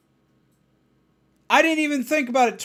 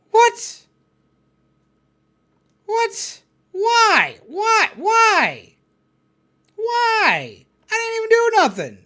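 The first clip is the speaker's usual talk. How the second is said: high, whiny disbelief; mock hurt innocence; bewildered whine; 11 semitones higher, much slower than usual. Cursed at by caller, high whiny disbelief.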